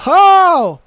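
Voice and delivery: loud, high mock lust at the robe; excited boyfriend. Boyfriend sees her in a robe, hammed-up excited. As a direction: ham up a guy seeing her in a robe. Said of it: "and he's like".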